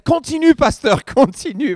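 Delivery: imitated high, loud urging cry from the audience; congregant calling out. Sister in the audience urging the pastor on, calling out. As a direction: call out high and eager, as a listener urging someone on. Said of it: "une sœur ... qui se lève".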